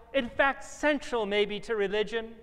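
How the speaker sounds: ordinary talk, nothing marked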